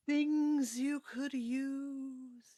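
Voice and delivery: high, loud, drawn-out ghostly call, fading; slow spirit cry dying away; trailing-off call. Acted. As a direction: wail it after departing visitors, trailing off. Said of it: "you hear sort of trail off".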